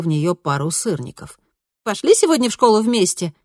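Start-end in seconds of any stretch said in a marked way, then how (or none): 1.8-3.3 put-on higher, brighter girl voice; lightly voiced cheerful teen girl; bright daughter voice. A narrator's reading. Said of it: "предложила она".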